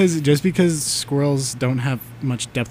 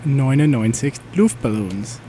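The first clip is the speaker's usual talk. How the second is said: German accent. Shown as a demonstration. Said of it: "as [name] would say in a German accent".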